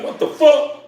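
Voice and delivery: a high, loud, stunned What the fuck; mock shock at something blinking back at you; stunned disbelief. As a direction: yelp it out like you can't believe your eyes. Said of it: "you like".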